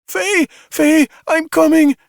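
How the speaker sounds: very high, loud desperate yell; frantic yell for her husband. A narrator's reading. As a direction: call down the stairwell to him. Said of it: "Xi yelled".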